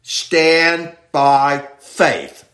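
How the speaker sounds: very slow, "stand by faith" drawn out; emphatic and slow. Shown as a demonstration. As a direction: stretch "stand by faith" word by word. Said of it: "he's very emphatic here".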